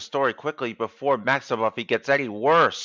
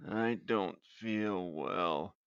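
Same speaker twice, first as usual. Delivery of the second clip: slow, soft, groaning complaint; sick groan; 2 semitones lower, 6 dB quieter, slower than usual. Acted. Sick man groaning to himself in bed, slow and soft. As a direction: drag the words out like you're ill. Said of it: "he groaned".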